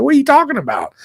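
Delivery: high, incredulous squeak at the news; mock disbelief. Newcomer told there are no drains, asking in high mock disbelief.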